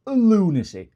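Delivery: high, loud, falling LUNACY; incredulous outburst. Real, not acted. Shopper balks at perfume price, incredulous outburst. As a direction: exclaim LUNACY in disbelief, pitched right up.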